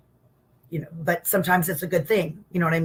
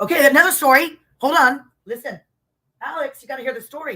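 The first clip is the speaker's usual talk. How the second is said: louder, higher burst of excitement; excited interruption; 7 semitones higher, 6 dB louder than usual. Real, not acted. Guest eagerly cutting in to tell the host, louder. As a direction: jump in eagerly with a new story. Sudden excitement over a story.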